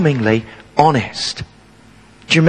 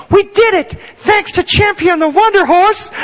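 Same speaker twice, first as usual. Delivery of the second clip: put-on much higher, louder shout of a cheering boy; kid's triumphant cheer; 14 semitones higher, 3 dB louder than usual. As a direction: shout it like a kid who just saved the day. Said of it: "He'd say".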